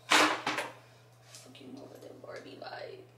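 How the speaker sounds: slow unvoiced whisper. Real, not acted. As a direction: breathe it under the breath. Muttering about the lighting.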